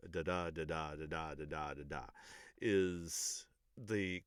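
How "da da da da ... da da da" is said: "da DA" repeated in an even beat; iambic rhythm. Shown as a demonstration. Teacher demonstrating iambic speech rhythm, "da DA" in even pairs. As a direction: stress every second "da", on a level pitch.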